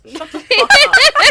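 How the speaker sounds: high, loud and slow, breaking into laughter; laughing yell. Acted. Roasting Susan's truck for cohosts, a laughing loud yell. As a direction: yell it while cracking up.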